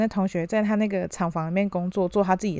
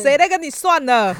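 mock retort; 6 semitones higher, 7 dB louder than usual. Employee snapping back at boss.

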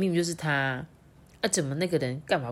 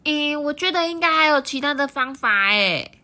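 high frog voice; 9 semitones higher, 5 dB louder than usual. Acted. Third frog thinks aloud.

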